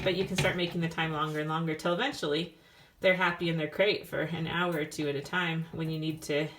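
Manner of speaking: usual speaking voice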